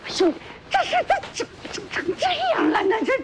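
high-pitched, stammering distress; flustered dismay. Acted. Mother learning what befell the young woman, flustered dismay. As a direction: stumble over the words, voice shooting up.

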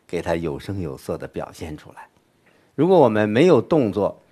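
ordinary delivery

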